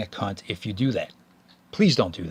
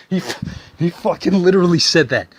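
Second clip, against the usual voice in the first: much higher, louder disbelief; can't believe he said it; incredulous; 7 semitones higher, 7 dB louder than usual. Real, not acted. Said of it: "disbelief at the retort".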